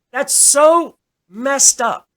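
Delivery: so messed up, spelled out slowly; emphatic outrage. Real, not acted. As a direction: drag it out, stressing so.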